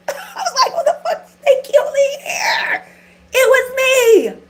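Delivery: high, loud laughing retelling; cracking up, voice up high and loud; shrieking laughter through the story; laughing hard. Real, not acted. Laughing hysterically retelling hospital scene.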